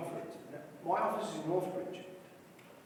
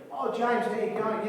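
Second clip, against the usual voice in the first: a lightly voiced pizza bar owner, loud and cheery; friendly greeting; 6 semitones higher, 9 dB louder than usual. Pizza bar owner greeting the property man, mildly hearty. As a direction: greet a regular warmly, a touch louder.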